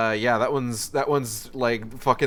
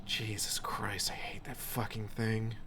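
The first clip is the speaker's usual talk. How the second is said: real nervous whisper; tense whisper; 11 dB quieter than usual. Real, not acted. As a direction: keep it hushed so the monster won't hear. Monster nearby, hiding quietly.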